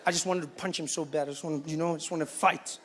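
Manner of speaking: ordinary talk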